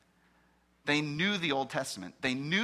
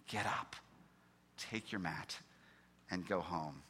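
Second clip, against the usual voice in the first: hushed command; 8 semitones lower, 10 dB quieter than usual. Acted. Jesus's gentle command, retold.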